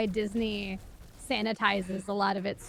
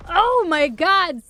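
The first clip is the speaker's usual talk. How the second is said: anguished exclaim; 7 semitones higher, 10 dB louder, slower than usual. Real, not acted. Anguish over a sad film.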